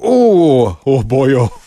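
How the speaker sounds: put-on Welsh accent exclamation; quick Welsh accent "oh boy". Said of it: "heard a Canadian do a Welsh accent".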